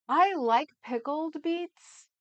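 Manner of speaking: high, slow sing-song over pickled beets; sing-song delight. Real, not acted. A viewer confessing she loves pickled beets, sing-song. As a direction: draw it out high and sweet. Exaggerated aside about beets.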